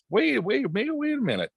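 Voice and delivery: high, rising double-take; sudden surprise. Retold or imitated. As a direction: pitch jumps way up on each wait. Spots a cheap rental listing.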